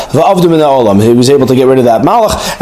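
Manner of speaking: normal talking voice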